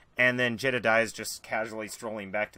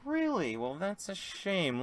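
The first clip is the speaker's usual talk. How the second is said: mock disappointment; 8 semitones higher, slower than usual. [name] feigns disappointment.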